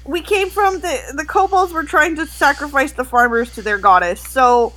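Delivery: high, teary voice building to a crying shout; a worried girl breaking into sobs; tearful worry. Acted. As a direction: plead through rising tears. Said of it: "she'll be like".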